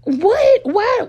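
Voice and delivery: high, loud wail of shock; tearful shock. Retold or imitated. Daughter hearing dad works at KFC, high tearful shock. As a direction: cry out in disbelief. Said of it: "my eyes started watering".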